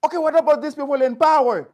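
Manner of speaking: retold heated question to best friend; indignant challenge. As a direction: challenge him hard. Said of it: "I'm like".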